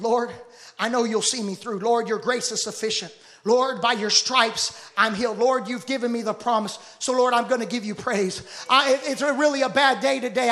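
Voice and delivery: sincere spoken prayer. Acted. Sustained prayer to the Lord.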